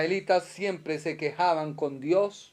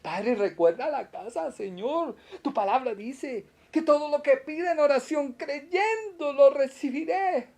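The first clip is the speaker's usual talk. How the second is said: fervent prayer; 8 semitones higher than usual. Acted. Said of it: "Sí, usted ora".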